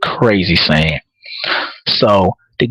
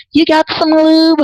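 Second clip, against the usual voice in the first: high mock woman asking, much higher; mock lady voice. Comedian mimicking ladies asking for lube, high mock voice. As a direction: squeak it out like a prim lady. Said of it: "like most ladies say".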